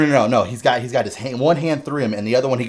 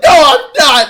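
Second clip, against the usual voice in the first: high, loud tearful denial; Inosuke; 16 dB louder than usual. Retold or imitated. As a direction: loudmouth yelling through mock tears. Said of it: "there's all these tears coming out".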